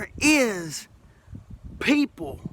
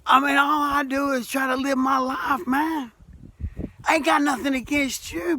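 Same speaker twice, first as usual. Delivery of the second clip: ordinary talk, nothing marked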